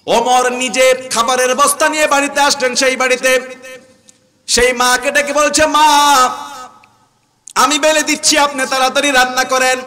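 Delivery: high-pitched dramatic retelling from the pulpit; fiery preaching. Retold or imitated. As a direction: declaim the tale to the crowd. Preaches a story.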